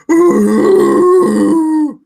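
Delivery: loud, high Wookiee howl; Wookiee roar. Acted. Wookiee player roars after his polite reply. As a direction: throw your head back and roar.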